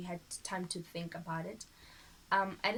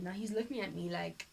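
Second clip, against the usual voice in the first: ordinary talk, nothing marked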